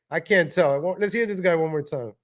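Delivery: ordinary talk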